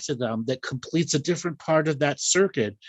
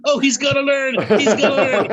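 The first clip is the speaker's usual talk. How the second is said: high, loud, eager and laughing; excited spirit guides; 11 semitones higher, 7 dB louder than usual. Retold or imitated. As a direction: voice the guides getting their hopes up. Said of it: "You get them excited".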